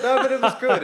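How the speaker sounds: high laughing burst. Real, not acted. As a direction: laugh through the words. Laughing through reassurance.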